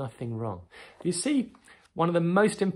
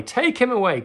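much higher, loud repeated yell; shout rising higher; 11 semitones higher, 7 dB louder than usual. Retold or imitated. Crowd repeating the demand to Pilate, higher and louder shout. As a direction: push the second cry up even harder. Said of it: "But they shouted".

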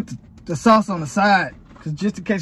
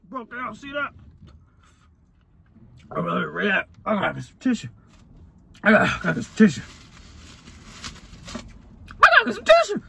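strained voice with burning mouth; mouth burning from spicy food; much faster than usual. Real, not acted. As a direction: talk through a burning mouth. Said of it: "My mouth's starting to hurt".

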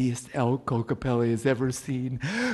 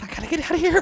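scared voice; 4 dB louder, much faster than usual. Acted. Character panics.

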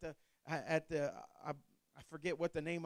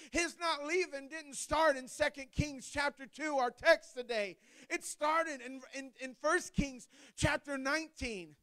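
higher-pitched preaching cadence building in force; 6 semitones higher than usual. Acted. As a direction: preach it with rising fervor to the congregation. Preaches on Elisha.

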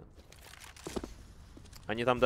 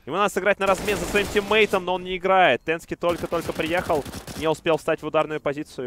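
louder, higher tense commentary; excited play-by-play; 5 semitones higher, 6 dB louder than usual. Real, not acted. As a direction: push up the tension.

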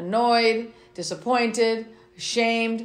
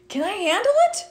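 pitched way up, rising question; high questioning refrain; 10 semitones higher than usual. Acted. Reading to kids, refrain asked high and rising. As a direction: ask it high like a curious kid. Child narrator asks the refrain.

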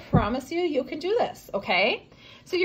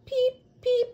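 high squeaky "Peep, peep"; high chick peeps; 13 semitones higher than usual. Acted. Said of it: "twin chicks".

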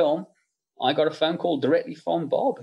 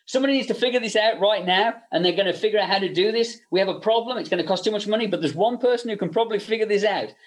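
raised, insistent boss voice hinting at one person; higher-pitched mock of a pushy producer; imitating Bob; 6 semitones higher than usual. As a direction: lean on the room with pointed urgency. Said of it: "he's like".

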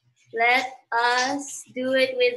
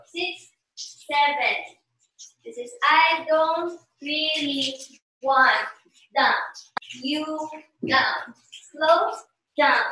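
slow, words spaced out with pauses; slow count-through; slower than usual. Shown as a demonstration. Dance teacher walking students through the last part, slowly. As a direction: mark the dance phrase by phrase, unhurried. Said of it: "let's do it again slowly".

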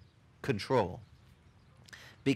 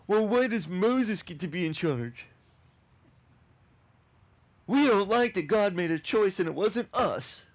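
higher, whining crowd voice; whiny complainers; 6 semitones higher than usual. Retold or imitated. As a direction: mimic the grumbling people, lightly. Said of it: "the people are like".

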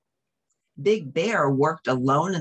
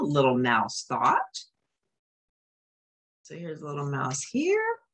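ordinary delivery, nothing marked

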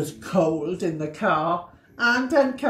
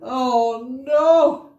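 high, loud, groaning Mister Bear; Mister Bear, fed up; 5 semitones higher, 7 dB louder than usual. Acted. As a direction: wail it like a sleepless bear at the end of his rope. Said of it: "said Mister Bear".

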